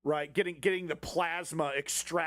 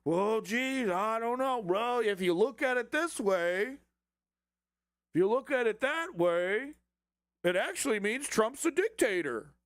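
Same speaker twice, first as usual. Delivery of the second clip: whiny hedging bureaucrat, pitched up; mock evasive judge; 5 semitones higher than usual. Mocking partisan judges dodging the plain law, in a high hedging voice.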